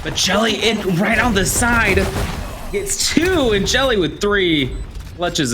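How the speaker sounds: high, fast hype for the clutch; excited call. Real, not acted. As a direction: lift the voice with each kill. Caster calls the clutch kills.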